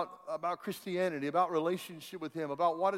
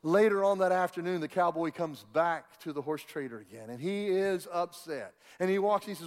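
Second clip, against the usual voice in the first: ordinary talk, nothing marked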